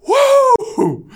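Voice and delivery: a huge, high, loud shout of release; spirit-filled cry of praise rising over the congregation; joyful whoop. Retold or imitated. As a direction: let it burst out of you in pure joy. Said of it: "a whoop just to get it out".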